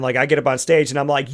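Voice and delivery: ordinary delivery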